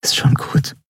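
whisper. A narrator's reading. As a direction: whisper it gently to him. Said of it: "flüsterte sie".

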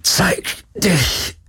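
slow, high strained call. Acted. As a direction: drag it out, taunting the unseen. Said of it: "rief er".

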